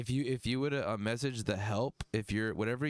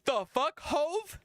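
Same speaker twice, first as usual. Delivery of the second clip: incredulous; 4 dB louder, slower than usual. Real, not acted. Mocking shout.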